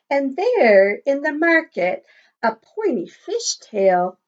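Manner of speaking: lilting, sing-song picture book read; reading to children. Acted. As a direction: read the rhyme aloud to a class. Reading rhyme to class.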